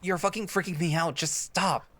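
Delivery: loud, high panicked yell to make him stop; frightened shouting. Acted. Scared boy shouting at Alex to stop talking. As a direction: scream at him to snap out of it.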